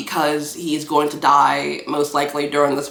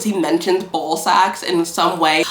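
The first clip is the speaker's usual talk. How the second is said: ordinary talk, nothing marked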